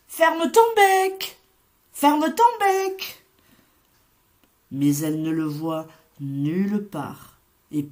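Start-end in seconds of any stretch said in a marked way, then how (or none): 0.1-3.2 calling out high and slow, worried. A narrator's reading. Mother hen anxiously calling her lost chick, high and slow. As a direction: call for the lost chick across the fields. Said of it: "Elle appelle, inquiète".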